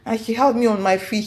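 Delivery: ordinary delivery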